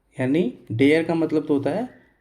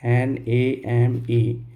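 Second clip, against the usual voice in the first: letters spelled one by one, very slowly; spelling the word for students; 2 semitones lower, much slower than usual. Shown as a demonstration. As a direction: dictate each letter slowly. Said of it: "मैंने लिखा name".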